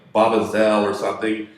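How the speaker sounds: usual speaking voice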